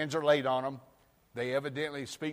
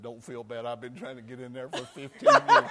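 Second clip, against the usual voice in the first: laughing punchline; 9 dB louder than usual. Acted. Jesus comforts man, punchline.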